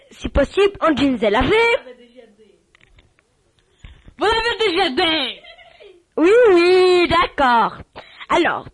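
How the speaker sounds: high, loud showy interviewer voice; host quizzing a star guest, hammed-up and high; excited radio host. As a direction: gush at the guest like a game-show host.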